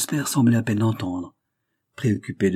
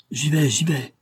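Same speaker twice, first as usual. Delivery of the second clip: higher-pitched, mildly raised call; 8 semitones higher, 3 dB louder than usual. A narrator's reading. Cashier answering the ringing doorbell, calling out. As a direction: call towards the door that one is coming. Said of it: "cria le caissier".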